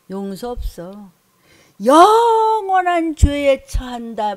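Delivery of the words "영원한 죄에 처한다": slow, high, loud pronouncement; thunderous verdict. Acted. (천천히 높고 크게 심판 선포)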